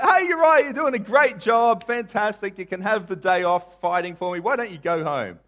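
high, loud, over-hearty praise; David's false heartiness. Acted. David flattering Uriah to send him home, over-hearty. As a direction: boom it out high, then ease off slightly.

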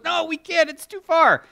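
high, loud complaining excuse; mock whiny protest; whiny Lot. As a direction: whine like a reluctant old man. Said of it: "He goes".

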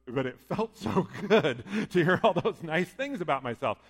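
loud, laughing through the words; sheepish delighted chuckle while talking. Retold or imitated. Sportscaster laughing about the caller's praise. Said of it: "But he goes".

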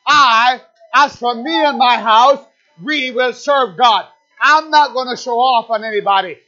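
loud, determined declaration; climbing to a loud, resolute vow; loud vow of faith. Acted. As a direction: declare it like a believer taking a final stand. Believer's vow voiced in sermon.

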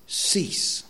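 "Cease" breathed out as a hushed command; emphatic whisper. Shown as a demonstration. Preacher repeating the verse's last word, hushed whisper. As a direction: whisper "Cease" like a final warning. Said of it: "Exclamation mark".

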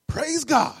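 loud, high burst of praise; jubilant praise. Acted. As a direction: exclaim it like a joyful pastor. Pastor's jubilant answer to bad news.

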